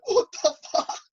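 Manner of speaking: high shocked outburst. Retold or imitated. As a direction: blurt it out in shock. Snowy finds girl.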